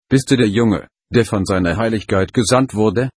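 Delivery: usual speaking voice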